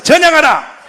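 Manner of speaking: loud chant for the crowd to repeat; call-and-response shout. Shown as a demonstration. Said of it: "다시요". (청중이 따라 외치도록 선창)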